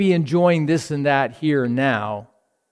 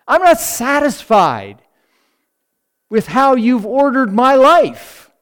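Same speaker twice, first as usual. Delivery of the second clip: loud, higher, discontented complaint to God; complaining to God; 9 semitones higher, 7 dB louder than usual. Retold or imitated. Believer complaining to God, loud and discontented. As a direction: air a grievance at God. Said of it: "we're saying".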